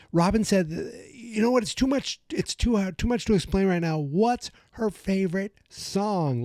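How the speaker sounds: high-pitched, flustered mock urgency; urgent impatience. Dustin demanding, no time to explain.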